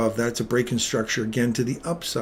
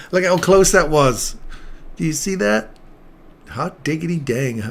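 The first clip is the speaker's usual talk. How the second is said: loud, high burst of real excitement at a near miss; shouting it out, thrilled the trade almost hit; excited; 5 semitones higher, 7 dB louder than usual. Real, not acted.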